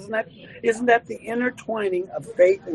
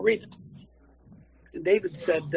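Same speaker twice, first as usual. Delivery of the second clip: normal talking voice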